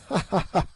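short gleeful witch cackle; high witch cackle. A narrator's reading. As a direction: cackle like a wicked old witch. Witch gloating over captives.

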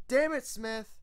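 high, loud exasperated yell. A narrator's reading. Smith yelling at the clumsy griffin, exasperated. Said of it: "the earth pony yelled".

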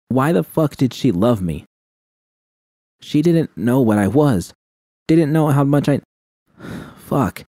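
usual speaking voice

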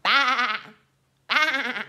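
loud bleated baa; playful sheep bleat; sheep noise. Shown as a demonstration. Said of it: "what does the sheep say".

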